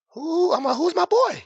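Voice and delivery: high, startled "Who?"; squeaky disbelief at the news; mock surprise. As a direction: jump way up in shock. Shocked at the king news.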